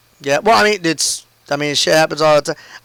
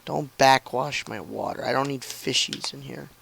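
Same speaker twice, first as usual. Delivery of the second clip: hushed, much softer, doting; soft tender teasing; 3 semitones lower, 10 dB quieter than usual. Real, not acted. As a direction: murmur fondly to your little one.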